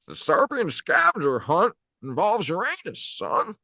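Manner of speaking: put-on high, drawling old-Hollywood Jimmy Stewart voice; Jimmy Stewart impression. Hoarse friend attempting a Jimmy Stewart impression despite his voice. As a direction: do a stammering classic-film leading man. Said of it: "I'd give you a Jimmy Stewart".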